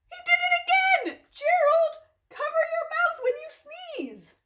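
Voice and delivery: high, loud exasperated outcry; mock scolding. Reader tells Gerald to cover his mouth, mock scolding. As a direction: scold in exaggerated outrage.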